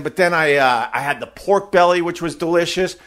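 ordinary talk, nothing marked

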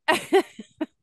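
bright laugh. Real, not acted. Laugh at host's remark.

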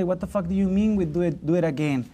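ordinary talk, nothing marked

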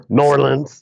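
New Orleans blended into one word; local pronunciation. Shown as a demonstration. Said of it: "constructive criticism".

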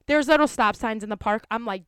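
ordinary talk, nothing marked

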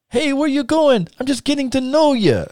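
very high Russian gimmick voice; Ivan Koloff. Retold or imitated. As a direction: taunt him in a put-on Russian accent. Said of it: "staying in his Russian gimmick voice".

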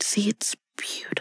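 hushed, unvoiced aside; mock-confidential whisper. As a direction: whisper it softly to the listeners. Addressing mothers, voice drops to whisper.

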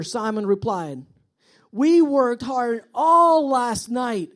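loud, slow, emphatic Bible reading; scripture reading. Acted. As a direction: read the verse out with weight and pauses. Reads scripture aloud emphatically.

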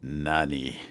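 "Nani?" said low and clipped, rising; low Nani repeat. Shown as a demonstration. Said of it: "let's hear that one more time".

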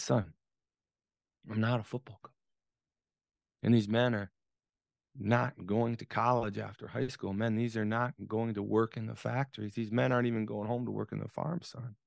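low, measured retelling of a father's words; kindly father. Retold or imitated. Father to his bewildered son, kindly and low. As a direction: speak gently and gravely, with long pauses. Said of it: "he kindly regarded me and said".

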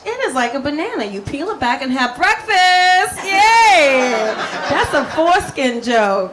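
loud, punchy stand-up set; stand-up delivery. Acted. On stage joking to the crowd about her husband, loud and punchy. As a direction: work the crowd with big energy.

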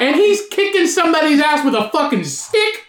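high-pitched hammed-up hollering; exaggerated high shout; mock outcry. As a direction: holler it over the other person in a put-on high voice. Keeps butting in with acted shout.

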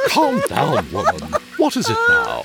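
high-pitched, impatient scolding; exasperated. Acted. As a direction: demand an explanation, voice rising in exasperation. Butler interrupts a household quarrel.

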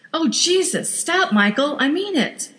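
high, upset demand; upset plea. Acted. Wife insisting her husband stop the car, high upset plea. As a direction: insist through rising panic.

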